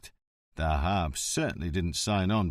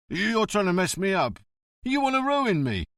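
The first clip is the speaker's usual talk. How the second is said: panicky protest; 10 semitones higher, 4 dB louder than usual. Acted. Prestia accused, panicking.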